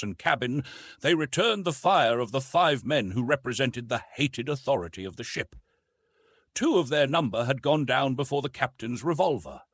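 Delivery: ordinary delivery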